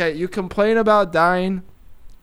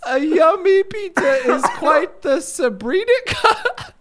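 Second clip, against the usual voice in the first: high, wheezing laugh through the line; laughing while reading; 8 semitones higher than usual. Real, not acted. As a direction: crack up reading it aloud. Reads lyric while laughing.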